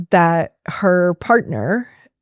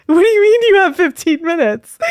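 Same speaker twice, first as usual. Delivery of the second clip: high-pitched, disbelieving protest; incredulous; 11 semitones higher, 4 dB louder, faster than usual. Retold or imitated. Therapist to client cutting a two-hour visit short, incredulous. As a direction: retell it as an incredulous, much higher objection.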